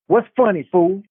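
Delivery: high, sharp demand; angry challenge. A narrator's reading. Brock wrestling Ace, angry and high. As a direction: bark it at him mid-fight.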